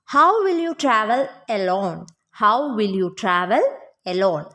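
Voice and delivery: slow, measured question for learners; slow model sentence. Shown as a demonstration. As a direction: say it slowly, phrase by phrase. Teacher models English question slowly.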